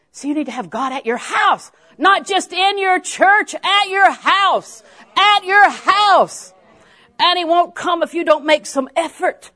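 high, loud, slow exhortation; full-force preaching. Real, not acted. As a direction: drive it home to the congregation, drawing out key words.

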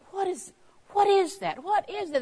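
puzzled wondering. Retold or imitated. Preacher recalling puzzling over the scripture, high and wondering. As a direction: wonder aloud at a mystery.

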